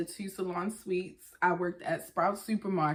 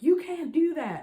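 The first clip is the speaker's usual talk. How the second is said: high, louder mock scolding; scolding coworkers; 10 semitones higher, 6 dB louder than usual. Coworkers catching the guys doing her work, loud mock scold. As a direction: snap it like a strict coworker. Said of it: "they were like".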